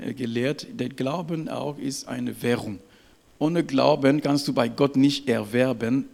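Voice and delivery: ordinary talk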